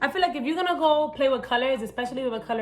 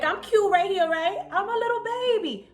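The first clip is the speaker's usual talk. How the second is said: put-on very high-pitched babyish voice; cute little baby; 10 semitones higher than usual. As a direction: play the cutesy baby, pitched way up. Acts cute little baby side.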